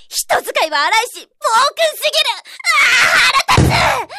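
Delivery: shouting in frustration, loud and high; angry outburst. Acted. Coworker venting to colleagues, furious shout. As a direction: blow up at the boss. Venting anger about the boss.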